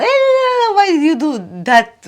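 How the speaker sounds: a high squawk of Eh, then half-hearted nagging; mock whiny yell. Complaining at her husband in a mild mock yell. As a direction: imitate a squeaky, habitual complaint. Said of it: "I yell on my husband".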